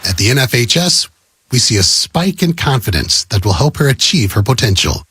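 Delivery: usual speaking voice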